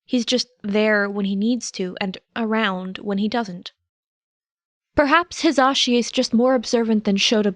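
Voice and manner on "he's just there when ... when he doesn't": ordinary delivery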